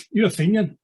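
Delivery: light imitation of a tough demanding an answer; hard questioning. Tough boy questioning in toilets.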